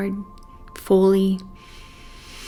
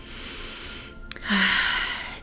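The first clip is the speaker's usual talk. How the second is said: long, slow audible breath; 5 dB quieter than usual. Shown as a demonstration. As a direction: let out a long, airy meditation sigh. Models a deep cleansing breath.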